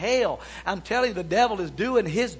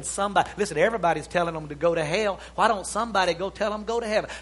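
ordinary talk

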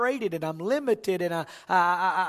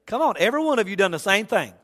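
ordinary talk, nothing marked

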